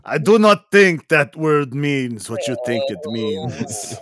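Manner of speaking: slow, deliberate swordsman line, lightly voiced; Princess Bride quote. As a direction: drawl the famous reply with mock gravity. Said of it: "The Princess Bride".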